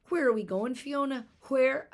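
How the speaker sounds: high, loud breathless cry; out of breath from running through the streets; panting. Acted. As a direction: gasp it out while running. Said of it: "Eilish panted".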